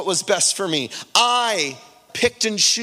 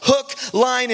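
usual speaking voice

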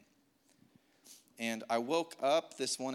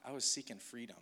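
ordinary talk

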